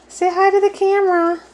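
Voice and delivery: high, sing-song coaxing of a parrot; high pet talk. Real, not acted.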